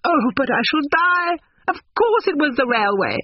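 very high, squealing amusement; helpless mirth. A narrator's reading. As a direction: gush as if about to die of hilarity. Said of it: "She could not control her mirth".

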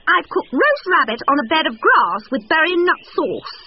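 high-pitched stork hostess; high, showy bird character. A narrator's reading. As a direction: announce the dish proudly in a high bird voice. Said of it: "said Stork to Fox".

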